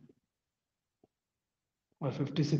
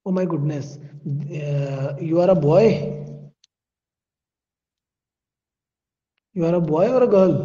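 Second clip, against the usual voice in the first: surprised; 3 semitones higher, 7 dB louder, much slower than usual. Real, not acted. Teacher surprised by student's gender.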